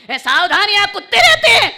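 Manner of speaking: high, shouted warning and account; loud, high-pitched preacher's delivery; full-force storytelling. Acted. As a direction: proclaim it at the top of your voice. Preacher belting out an anecdote.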